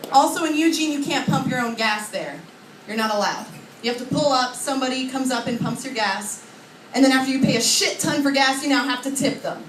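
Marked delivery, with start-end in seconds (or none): none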